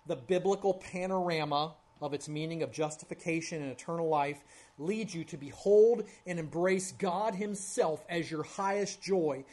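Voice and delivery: usual speaking voice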